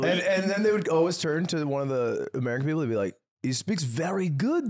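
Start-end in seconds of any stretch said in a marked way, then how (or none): 3.4-4.7 slow, higher, lightly put-on foreign voice; a hint of a French speaker, slow and impressed; mock French praise. Imitating French guests telling Americans about him, slow mock praise.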